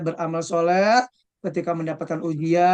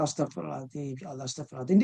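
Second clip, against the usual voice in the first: soft istighfar; 6 semitones lower, 8 dB quieter, much faster than usual. Retold or imitated. Preacher voices patient person's istighfar.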